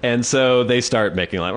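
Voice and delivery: normal talking voice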